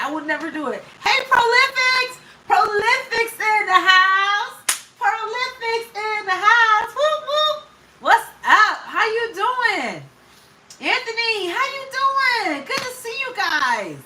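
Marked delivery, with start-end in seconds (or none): 1.0-7.6 high, drawn-out welcome call; hyped shout-out. Real, not acted. Calling out to arriving viewers. 8.0-14.0 high-pitched, stretched-out hello; excited greeting. Real, not acted. Greeting arriving viewers by name.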